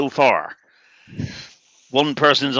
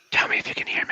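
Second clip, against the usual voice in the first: breathy whispered test; whispering; 7 dB quieter, faster than usual. Real, not acted. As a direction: whisper it into the mic.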